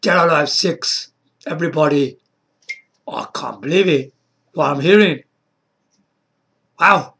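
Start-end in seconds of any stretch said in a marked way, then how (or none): none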